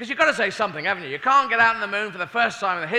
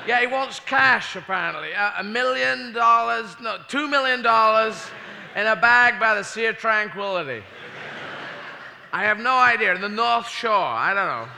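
high, loud, slow hostage relaying demands; lightly voiced hostage astronaut; relaying ransom demands; 5 semitones higher, 5 dB louder than usual. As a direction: nervously pass on the monster's ransom. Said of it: "he says".

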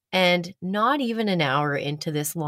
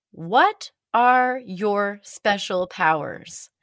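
slow, weighty reading of a strange note; each word laid out slowly for effect; dramatic slow read; 3 semitones higher, much slower than usual. Retold or imitated. Reading the stranger's napkin note to the listener, slow and dramatic. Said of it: "Let me repeat that".

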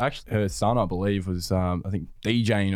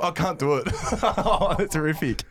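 high, giggly giving up; real laughter breaking through the words; laughing helplessly; 7 semitones higher than usual. Real, not acted. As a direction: crack up at your own terrible attempt. Cracking up at own failed accent.

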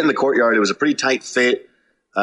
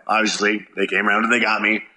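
usual speaking voice

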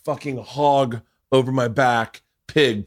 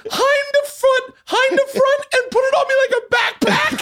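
very high voice between laughing and crying; hysterical squealed retelling. Real, not acted. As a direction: squeal it out, completely overcome. Overcome while telling the gruesome story.

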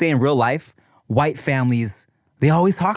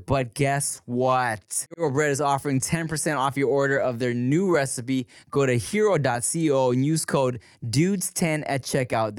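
ad read; 6 dB quieter than usual. Real, not acted. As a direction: sell it with measured, stretched enthusiasm. Reads a sponsor ad.

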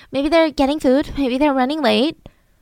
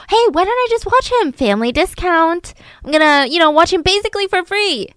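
high, bright-voiced friendly offer; sweet eager Lacey; 6 semitones higher than usual. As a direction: sell it like a favour, higher.

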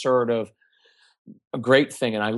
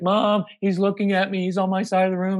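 put-on higher, whiny complaining kid; whiny tattling little sibling; 7 semitones higher than usual. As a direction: tattle to mom, whining. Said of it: "they're gonna be like".

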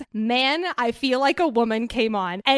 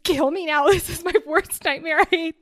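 laughing mock despair; 5 semitones higher, 4 dB louder than usual. Retelling humiliation, laughing.

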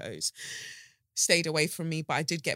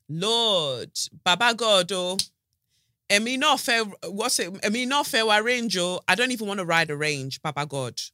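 Nigerian exclamation; 4 semitones higher, 4 dB louder than usual. Pretend. Nigerian exclamations about journey.

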